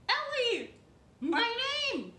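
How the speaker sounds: excited. Real, not acted. Proudly naming herself.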